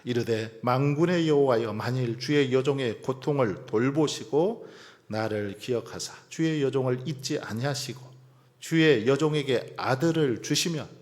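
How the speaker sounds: loud, emphatic reading of a vow; scripture reading. Acted. Preacher reading Hannah's vow to God, loud scripture reading. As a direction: declaim the prayer firmly, settling at the close.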